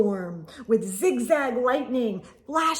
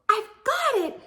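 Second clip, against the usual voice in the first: much higher, louder burst of an idea; excited cry; 11 semitones higher, 4 dB louder than usual. Acted. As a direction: snap into the plan. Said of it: "she cried".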